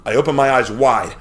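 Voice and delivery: usual speaking voice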